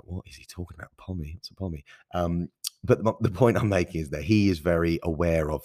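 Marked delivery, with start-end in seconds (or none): 0.0-1.9 whispered, puzzled American listener; confused American listener. Retold or imitated. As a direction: breathe it out like a baffled listener asking aside. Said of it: "English for you American listeners".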